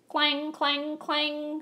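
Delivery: high loud clang of a trolley; a trolley bell clanging high and loud; screeching trolley bell. A narrator's reading. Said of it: "screeched a trolley car".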